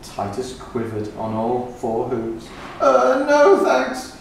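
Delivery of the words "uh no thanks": much higher, louder, slow frightened cry; panicked goat crying no. Acted. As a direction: cry it out, scared stiff. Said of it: "he cried".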